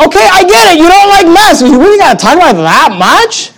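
high, incredulous sarcasm; mock-patient eye-rolling reply to the livestreamers; exasperated. Real, not acted.

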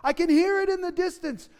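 breathless alarm at a far-off voice; urgent listening. Shown as a demonstration. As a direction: call the crowd to hush and hear. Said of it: "The effect is this".

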